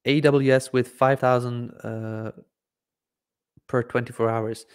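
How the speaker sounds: ordinary talk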